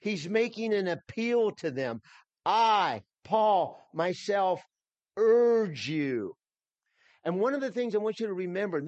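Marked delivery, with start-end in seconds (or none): 2.5-6.4 "I" and "Paul" louder and higher, "urge" kept loud; emphatic. Shown as a demonstration. Pastor urging the congregation in Paul's voice, emphatic. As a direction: press each word home, slowly building to "urge you". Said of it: "This is very emphatic".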